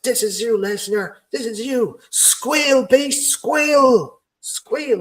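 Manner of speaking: high, strained wrestler's taunting yell; mock screaming. Wrestler taunts Lesnar while locking in the recliner, screaming. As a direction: imitate a wrestler screaming at his victim, pitch way up. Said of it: "he keeps screaming".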